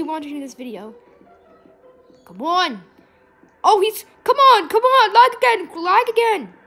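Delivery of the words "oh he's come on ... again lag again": high, loud frustrated outburst; exasperated shouting. Real, not acted. Player hit by lag again, exasperated high shouting. Game lags mid chase.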